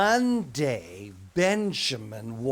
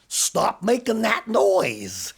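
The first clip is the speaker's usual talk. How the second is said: stern teacher; 5 semitones higher, 5 dB louder, much slower than usual. Acted. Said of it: "Teacher looked at him and said".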